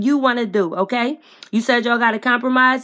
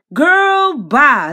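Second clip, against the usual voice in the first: loud dismissal; 4 semitones higher, 7 dB louder than usual. Real, not acted. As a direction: wave her off with a loud cry. Dismissive outburst at Queen.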